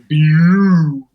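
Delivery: loud, gliding imitated "pew"; brain-reboot noise. Imitating rock star's brain rebooting, loud sound effect. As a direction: make a machine-like rebooting sound. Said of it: "had to, like, reboot his brain for a second".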